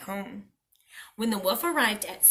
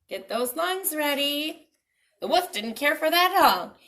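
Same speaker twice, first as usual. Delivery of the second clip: plain reading voice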